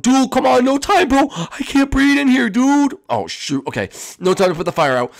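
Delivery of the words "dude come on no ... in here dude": high, loud voice gasping for air; a friend who can't breathe in the smoke; choking friend. Acted.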